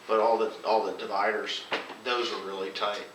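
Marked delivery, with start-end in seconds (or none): none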